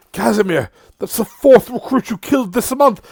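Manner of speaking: high, loud accusing yell. Acted. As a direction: shout it across the hall. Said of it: "yelling at him".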